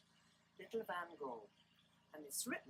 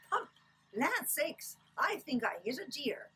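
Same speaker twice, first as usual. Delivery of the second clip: put-on high, loud startled old woman; startled exclamation; 9 semitones higher, 7 dB louder, slower than usual. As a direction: cry out in alarm as the grandma. Grandma startled after a thump.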